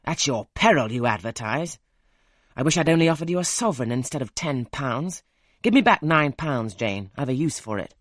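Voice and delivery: loud, insistent scolding; forceful and loud throughout; angry demands. A narrator's reading. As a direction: bark the demands at her. Rochester angry over the money.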